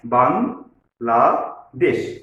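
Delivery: বাংলাদেশ in three drawn-out syllables, pausing between each; teacher slowly splitting বাংলাদেশ into its three syllables. Shown as a demonstration. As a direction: say বাংলাদেশ very slowly as বাং, লা, দেশ. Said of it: "একটু ধীরে ধীরে উচ্চারণ করব".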